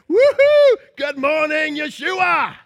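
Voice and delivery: high, loud woohoo and greeting called across the street; joyful shout. Pretend. Believer calling to Jesus across the street, joyful shout. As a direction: hail him joyfully from the doorstep.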